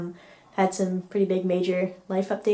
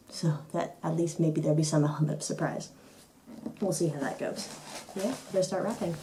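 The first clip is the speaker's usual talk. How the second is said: slightly hushed; 3 dB quieter than usual. Real, not acted. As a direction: keep your voice down for the household. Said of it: "talk a little bit quietly 'cause there's other people in the house".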